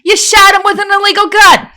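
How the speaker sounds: put-on loud, high Long Island accent. Karen scolds her friend in a loud Long Island accent. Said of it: "her Long Island accent started to make it".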